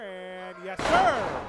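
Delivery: sudden loud triumphant call; excited shout. Real, not acted. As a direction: shout it as the move hits. Big move lands, excited call.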